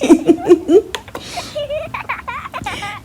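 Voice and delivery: very high squealing laugh. Real, not acted. Laughs at own confession.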